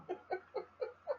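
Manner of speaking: squealing high laugh. Real, not acted. Laughs at own joke.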